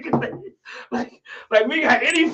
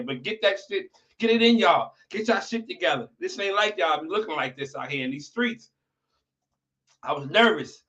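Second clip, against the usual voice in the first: ordinary delivery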